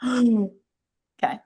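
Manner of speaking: sharp gasp of shock. Shown as a demonstration. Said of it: "Gasping sound effect".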